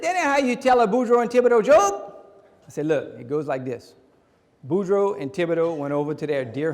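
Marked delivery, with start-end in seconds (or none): 0.0-1.9 loud, high-pitched protest; worked-up comic outrage; indignant correction. Acted. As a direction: object loudly, pitch jumping up. Indignant correction of English friend.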